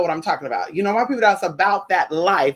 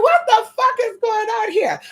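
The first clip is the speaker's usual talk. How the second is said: put-on high, shocked child voice; shocked little cousin. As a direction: squeal it like a shocked nine-year-old. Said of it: "She was like".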